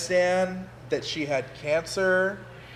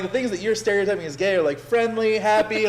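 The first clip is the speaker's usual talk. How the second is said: high, fast, emphatic comeback; animated retort; 7 semitones higher, 5 dB louder than usual. Retold or imitated. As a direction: raise the pitch and push it out quickly. Retorting to stereotypers, voice raised.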